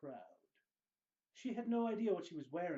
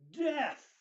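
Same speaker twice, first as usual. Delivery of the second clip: a sudden, much louder and higher cry of Death!; clear loud exclamation; 10 semitones higher, 9 dB louder than usual. Acted. Shopkeeper shows the stone, loud sharp announcement. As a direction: ring out the single word Death! sharply. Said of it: "the proprietor said clearly".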